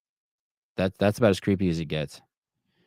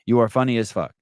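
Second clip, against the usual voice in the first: ordinary delivery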